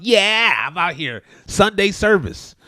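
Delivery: high, loud impression of an eager churchgoer; imitating a gung-ho Sunday worshipper; mock enthusiasm. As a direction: cheer it mockingly with drawn-out words.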